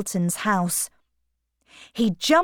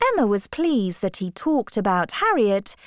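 usual speaking voice

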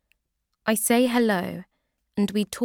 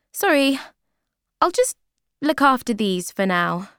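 nervous high bluff; 5 semitones higher, 6 dB louder than usual. Acted. Becky grabbing the jeans.

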